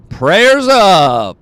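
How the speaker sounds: high, loud hype call to the viewers; hollered sign-off, half joking; rallying call. Acted. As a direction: whip up the crowd with a big send-off.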